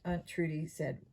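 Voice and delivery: usual speaking voice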